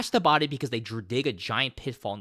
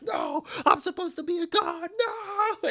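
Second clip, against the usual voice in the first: much higher screamed denial; mock death scream. The commander being eaten by zombies, high mock scream.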